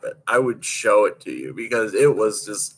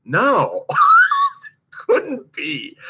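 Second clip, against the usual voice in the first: a high cry of a laugh between the words; laughing denial; 5 dB louder, much slower than usual. Retold or imitated. Skater answering her coach's time, high laughing denial. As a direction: refuse to believe it through laughter. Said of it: "I was like".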